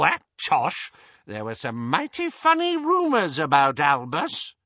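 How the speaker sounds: put-on high, eager old busybody; high-pitched elderly lady; gossiping old aunt. A narrator's reading. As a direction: gossip keenly in a shrill old voice. Muriel gossips.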